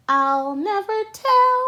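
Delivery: high, sly smiling murmur; smiling to herself. A narrator's reading. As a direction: keep a secret with a smile. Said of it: "she said to herself with a smile".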